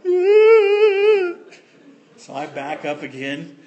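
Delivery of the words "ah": high, loud scared wail; frightened wail. Retold or imitated. Little Ricky on the diving board wails in fear, high and loud. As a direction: squeal like a terrified little kid. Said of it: "And I'm like".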